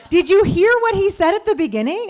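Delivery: mock exasperated question; incredulous. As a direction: demand he noticed. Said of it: "And I'm like".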